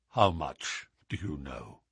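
low, softened, downcast question; subdued, defeated. A narrator's reading. Duke at last questions Holmes, low and defeated. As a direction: ask it low, head sunk in hands. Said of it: "he asked at last, without raising his head".

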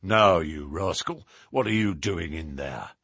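low, drawn-down voice of someone catching a rascal; deep, stern accosting voice; stern challenger. Acted. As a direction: drop the pitch right down and challenge the intruder. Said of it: "and a voice said".

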